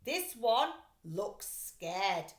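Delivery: slow, wary drawl; suspicious thief. Acted. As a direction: eye her suspiciously. Luther suspicious.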